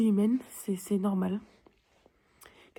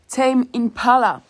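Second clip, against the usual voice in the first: loud announcement; 4 semitones higher, 14 dB louder, much slower than usual. Real, not acted.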